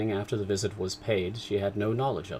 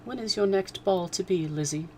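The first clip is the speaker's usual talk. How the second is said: put-on high girlish voice; a girl asking her sister; Kitty asking Lizzie; young girl; 10 semitones higher than usual.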